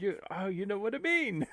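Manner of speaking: normal talking voice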